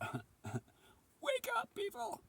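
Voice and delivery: very high, thin squeaky call; voice of the moon. Acted. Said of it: "So the moon says".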